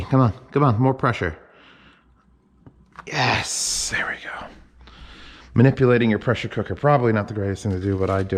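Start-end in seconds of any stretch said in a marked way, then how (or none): 3.1-4.5 whispered "there we go"; soft whisper of success; whispered relief. Real, not acted. Relief as the cooker releases.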